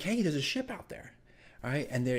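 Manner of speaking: ordinary talk, nothing marked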